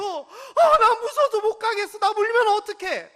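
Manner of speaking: high, loud whiny fear through laughter; laughing mock whine. Wife whines she is too scared to pass, laughing.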